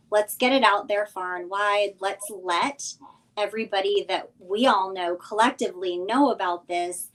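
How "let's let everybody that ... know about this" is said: ordinary talk, nothing marked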